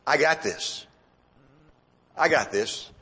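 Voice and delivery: low, private self-assurance; self-assured thought. Acted. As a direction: say it low, as if thinking to yourself. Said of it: "what was going through his mind".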